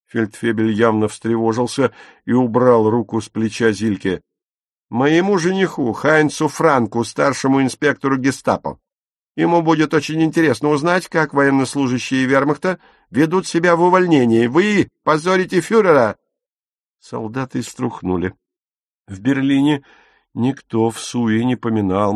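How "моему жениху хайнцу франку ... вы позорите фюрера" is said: loud, higher, fast angry scolding; woman scolding soldier, loud and fast; furious tirade. A narrator's reading. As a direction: tear into him, quick and loud. Dagmar threatens with Gestapo fiancé.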